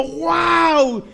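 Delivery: high, long mock "wow"; imitated wide-open amazement; awed crowd. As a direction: marvel like an amazed audience. Crowd's amazed reaction, acted.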